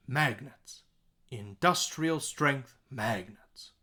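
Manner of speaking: MAG, DUS and STRENGTH lifted in "Industrial strength magnets"; beat pattern stresses. Shown as a demonstration. Teacher putting the words back on the beat, slow stresses. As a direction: slowly punch the strong beats of "Industrial strength magnets". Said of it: "so our beat pattern".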